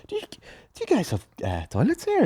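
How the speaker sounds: high, soft, overly polite asking; mock polite. Customer pretending not to need the toilet, mock polite. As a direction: put on a sweet innocent ask.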